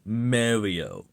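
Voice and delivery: MARIO said as MAIR-ee-oh; wrong pronunciation. Retold or imitated. Quoting the viewer's version, wrong pronunciation. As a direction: say Mario with a "mare" first vowel. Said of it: "it's not Mario".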